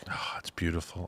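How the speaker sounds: low, breathless whisper of fake wonder; mock awe. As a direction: gush in a hushed, sarcastic hush. Sarcastic awe at the quote.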